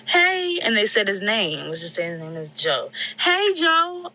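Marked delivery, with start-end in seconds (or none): 0.1-0.6 high, loud call from outside; yelled greeting. Retold or imitated. A stranger calls up to the window, yelling hey. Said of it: "I hear somebody yell out". 3.2-4.1 high, loud hey, Joe called from afar; yelled name. Retold or imitated. A woman outside yells his name toward the bedroom window. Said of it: "I hear somebody yell".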